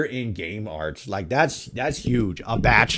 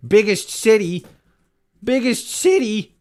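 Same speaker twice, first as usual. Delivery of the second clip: mock aggressive; 11 semitones higher, 5 dB louder than usual. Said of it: "I'm fucking getting so aggressive".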